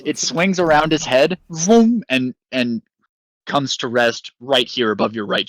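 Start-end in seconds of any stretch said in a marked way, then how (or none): 1.5-2.0 high, loud zipping vroom of a flying drone; whooshing vroom. Retold or imitated. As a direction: zip the drone past with a swoosh. Drone swinging around head.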